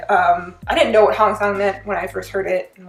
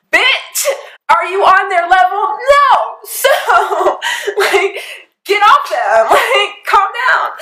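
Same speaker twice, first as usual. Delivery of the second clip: exasperated; 9 semitones higher, much slower than usual. Real, not acted. Fan squealing at the braggers in disbelief. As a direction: squeal it at them, half laughing.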